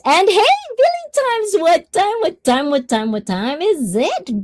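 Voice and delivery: high-pitched hype greeting, easing down as it repeats; excited shout-out. Real, not acted. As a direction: hail a chatter with playful, high-energy repetition. Excitedly greeting a viewer by name.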